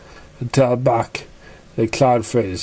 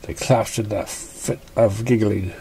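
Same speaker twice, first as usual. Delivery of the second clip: ordinary delivery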